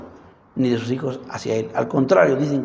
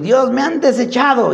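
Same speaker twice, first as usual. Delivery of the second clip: high, louder cry of being rejected; hurt complaint; 9 semitones higher, 4 dB louder than usual. Acted. Samuel protesting to God that he was rejected, high and hurt. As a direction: complain to God with wounded pride. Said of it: "Samuel se enojó, fue y le dijo a Dios".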